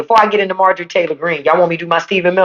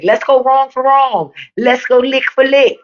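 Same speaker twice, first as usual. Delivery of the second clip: [name]; 5 semitones higher than usual. Retold or imitated. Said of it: "I'm like [name] say".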